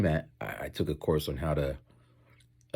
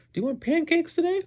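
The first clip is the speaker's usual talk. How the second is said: imitated offer; 4 dB louder than usual. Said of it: "if someone was like".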